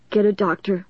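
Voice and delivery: faltering, pained plea; hurt and halting. A narrator's reading. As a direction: struggle through the pain, breaking off. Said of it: "he grimaced in pain".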